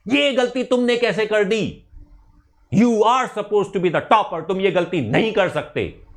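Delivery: mock scolding. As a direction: tell off a star student, mildly. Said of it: "तब मुझे उन पे गुस्सा आता था". (छात्र को डाँटते हुए हल्का गुस्सा)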